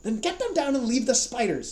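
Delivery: high, loud command; high loud order; shout. Acted. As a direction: bark it back through the door. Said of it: "said Howell".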